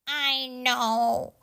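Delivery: a touch of sad resignation; sadly. Acted. Franklin accepting the move to a bigger house, mildly sad. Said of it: "Franklin said sadly".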